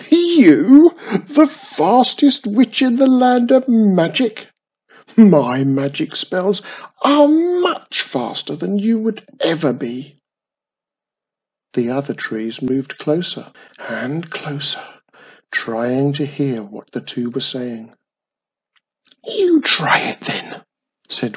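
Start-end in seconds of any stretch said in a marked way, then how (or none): 0.1-10.2 high, loud mocking disbelief; mocking tree. A narrator's reading. The old tree mocking the witch's boast. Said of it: "let out a really deep laugh". 19.2-20.6 high breathy whispered challenge; whispered dare. A narrator's reading. Witch challenging the tree in a whisper.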